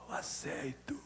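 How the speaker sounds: imitated faint patient's murmur; very soft, low, feeble voice; speaking low. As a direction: murmur it weakly, barely audible. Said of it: "tava falando baixo".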